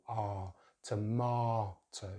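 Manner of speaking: slow "tomato", jaw dropped on MAH; ah, then "tomato" stretched by syllable; British pronunciation. Shown as a demonstration. Teacher drills second syllable, slow "tomato" with ah. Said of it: "Really drop that jaw".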